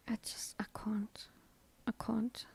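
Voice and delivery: exasperated whisper. Retold or imitated. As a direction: whisper it in disbelief. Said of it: "I'm like".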